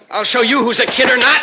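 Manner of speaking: heated, high-pitched threat; angry challenge. Acted. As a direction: square up for a fight.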